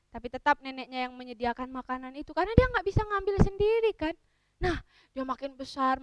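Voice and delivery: plain reading voice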